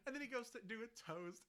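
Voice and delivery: normal talking voice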